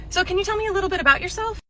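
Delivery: high, quick, put-on brightness; fake-cheery interviewer. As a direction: chirp it, covering your panic. Faking composure as interviewer.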